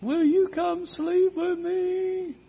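put-on very high, drawn-out singsong child voice; young daughter calling. Daughter calling her father from across the house, imitated child's call. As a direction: imitate a little girl calling out slowly to Daddy. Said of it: "I'd hear a voice from the other side of the house".

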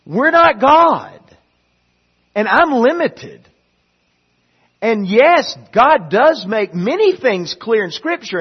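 loud, high preaching; preaching at full force. Acted. Preacher pressing the point to the church, loud preaching. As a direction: drive it home from the pulpit.

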